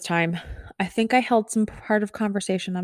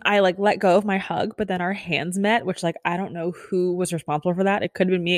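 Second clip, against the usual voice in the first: normal talking voice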